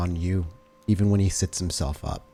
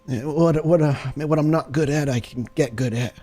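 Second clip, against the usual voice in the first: eager wounded man; 7 semitones higher than usual. Acted. John voiced by player.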